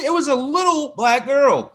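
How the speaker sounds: high mock-outraged squeal; outraged fan impression. Mocking outraged fans again.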